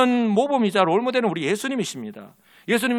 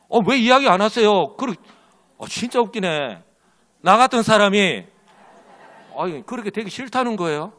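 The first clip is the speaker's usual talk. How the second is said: normal talking voice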